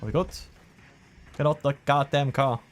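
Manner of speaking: usual speaking voice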